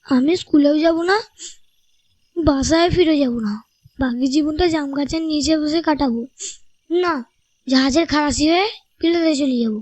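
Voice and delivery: put-on high, tearful child's voice; choked up. A narrator's reading. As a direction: vow stubbornly like a tearful little boy. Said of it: "Bablu ধরা গলায় বলল". (ধরা গলায়)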